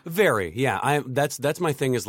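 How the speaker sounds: ordinary talk, nothing marked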